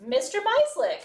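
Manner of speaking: single out the student mid-lecture; teacher calling out. Retold or imitated. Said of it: "I would stop and go".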